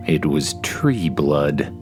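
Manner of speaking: plain reading voice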